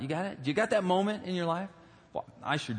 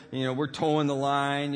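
usual speaking voice

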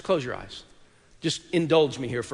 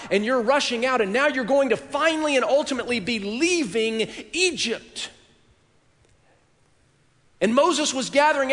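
rising, louder and higher with every line as the crowd hunts for Moses; preaching at full force; 8 semitones higher, 5 dB louder than usual. Acted. Preacher to congregation, climbing to a shout as they search for Moses. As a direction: build the scene up to a pleading cry. Preacher building the Exodus scene.

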